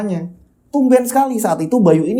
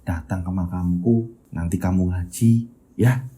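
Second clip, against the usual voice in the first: suara Bayu lirih; 10 semitones lower, 5 dB quieter than usual. Acted. Bayu's voice in the story. (suara anak lirih dan pelan, seperti berpamitan)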